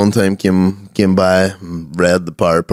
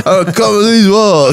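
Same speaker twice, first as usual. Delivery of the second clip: higher, louder hammed-up singer boast; mocking the singer; 11 semitones higher, 3 dB louder than usual. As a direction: overdo the singer's bravado. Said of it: "mocking the singer".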